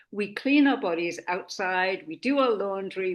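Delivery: usual speaking voice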